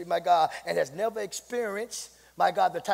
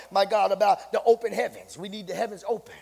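ordinary talk

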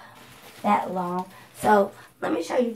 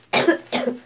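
dry cough; real cough; allergy cough; 5 dB louder than usual. Real, not acted. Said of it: "My allergies".

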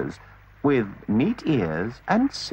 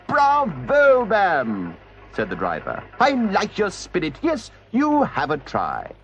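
hearty driver; 7 semitones higher than usual. Acted. Driver praises the washerwoman's spirit, hearty voice. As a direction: cheer her on heartily.

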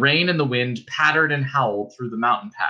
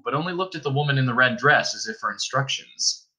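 ordinary delivery

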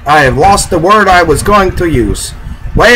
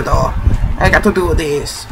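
ordinary talk, nothing marked

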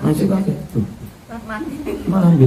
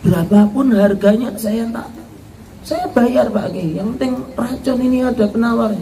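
a lightly higher voice begging for the antidote; pleading daughter-in-law; 6 semitones higher, 3 dB quieter than usual. Acted. Daughter-in-law begs the Kiai for an antidote, lightly pleading.

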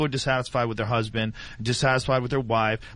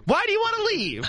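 mock wife. Mocking the shooter wife.

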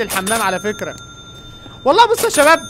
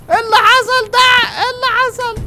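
shocked outcry; 13 semitones higher, 7 dB louder than usual. Real, not acted. Finds chairs knocked over.

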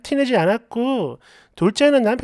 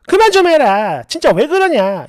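louder, higher retold outburst; exploding shout; 3 semitones higher, 6 dB louder than usual. Wife finally exploding at her drunk husband, shouting. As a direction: blow up at him, loud and high. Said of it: "그래서 폭발했어요".

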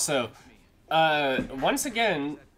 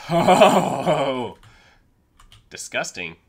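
loud delighted disgust; 4 semitones lower, 17 dB louder, much slower than usual. Real, not acted. Loot reaction.

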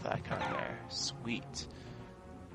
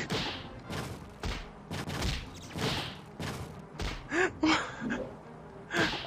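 long, high fit of laughing; high, incredulous laughter; helpless laugh; 13 semitones higher, 4 dB quieter than usual. Real, not acted. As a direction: laugh through the panic. Shocked laughing at enemies.

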